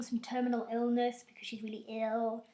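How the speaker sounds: ordinary talk, nothing marked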